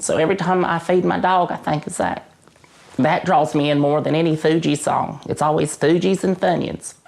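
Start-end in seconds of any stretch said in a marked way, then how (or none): none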